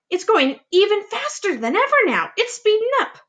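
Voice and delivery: very high, thrilled voices; excited aunts. Acted. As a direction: squeal as it speeds up. Aunts excited at speeding peach.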